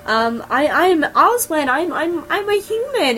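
high, pitiful pleading voice; sad pleading. Retold or imitated. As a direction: plead pitifully, imitating someone desperate to be believed. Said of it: "it's so sad, 'cause she's like".

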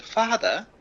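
put-on high, earnest old-fashioned son; old-timey voice. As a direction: call out like a boy from an old film. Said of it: "give him an old-timey voice".

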